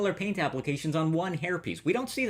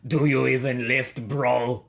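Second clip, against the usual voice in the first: put-on thick Russian accent, louder; 3 dB louder, slower than usual. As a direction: gym bro line in a heavy Russian accent. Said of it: "in what is surely an impenetrable Russian accent".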